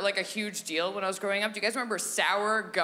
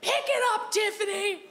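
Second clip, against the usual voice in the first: high, hard playground scolding; mock furious order; 10 semitones higher than usual. Kid berating the friend who was scared, mock furious high order. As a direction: lay into a cowardly friend, hammed-up.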